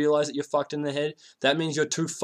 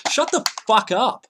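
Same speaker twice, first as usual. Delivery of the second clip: sudden loud, higher, slower shout; angry outburst; 8 semitones higher, 6 dB louder than usual. Real, not acted. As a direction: snap at them to shut up, louder and higher. Rant turns to yelling at people.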